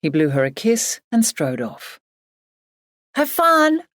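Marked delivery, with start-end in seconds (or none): 3.1-3.8 high, raised voice calling after him. A narrator's reading. Emily calls after her departing husband, calling out. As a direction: call it out high and far off to him. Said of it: "she called".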